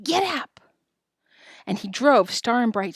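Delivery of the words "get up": high call, held back in volume; narrated holler; mild shouted command. A narrator's reading. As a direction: urge the calves on with a higher voice. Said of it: "cracked his whip and shouted".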